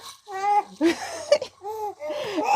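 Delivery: sudden high startled squeal; scared high squeal. Retold or imitated. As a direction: squeak like you just got spooked. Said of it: "I be like".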